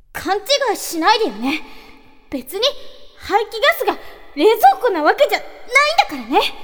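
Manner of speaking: high, loud tsundere voice. Acted. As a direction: snap it like a flustered anime girl. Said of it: "いきたいと思います".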